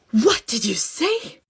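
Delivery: slow imitated shocked question; mock scandalised. Lady confronts strangers she overheard, mock scandalised. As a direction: imitate an offended passer-by, drawn out.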